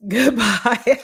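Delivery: loud goodbye through laughter; real laughing goodbye. Real, not acted. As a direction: laugh while waving them off.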